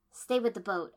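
loud, curt command; snapped. A narrator's reading. The heroine to Sai, loud snapped order. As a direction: clip the order at him. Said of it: "I snapped at him".

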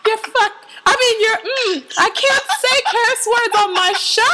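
high, exasperated and amused; real flustered squeal of protest. Real, not acted. Flustered host, cracking up, near swearing.

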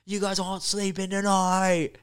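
high, loud, slow barking order; hammed-up hostile local shouting at the Americans; mock foreign yell. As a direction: wake them up by shouting like an angry local. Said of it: "they go".

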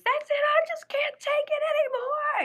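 very high, loud mock wail; hammed-up breaking-point shriek; retold scream. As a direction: imitate an overwhelmed woman screaming she's done. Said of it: "And she just screams".

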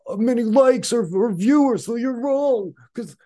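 very high squeaky mocking voice; mocking sheep critic. Mocking people who judge by likes, squeaky and high. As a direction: squeak it like a smug fool. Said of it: "I'm like".

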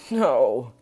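loud cry of grief; grief-stricken outburst; anguished cry. A narrator's reading. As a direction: cry out loudly, falling in pitch. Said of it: "he cried and began to weep".

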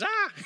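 a sudden high shriek of surprise; sharp high startled cry, loud. Retold or imitated. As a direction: shriek like a toddler hitting cold snow. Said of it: "Then freaks out and goes".

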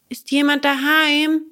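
high, loud call into the hut. Acted. Bengele calls into the empty hut, loud. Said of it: "fragte Bengele".

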